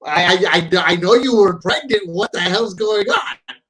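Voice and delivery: high, loud, baffled outburst; retold disbelief. Host re-enacts his shocked reaction.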